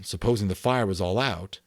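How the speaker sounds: usual speaking voice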